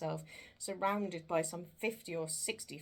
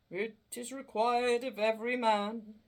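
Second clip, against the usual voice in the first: solemn ghost; 5 semitones higher, 7 dB louder than usual. Acted. Ghost of Marley speaks.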